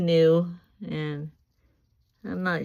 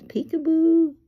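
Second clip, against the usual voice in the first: very high, loud parrot-style calls; imitating parrot talk; 9 dB louder than usual. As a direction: squeal it like a talking bird. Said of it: "He says".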